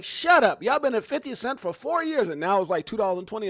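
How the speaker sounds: loud, high mock snap at doubters; mock retort. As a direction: tell them off, half-joking. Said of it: "You're like".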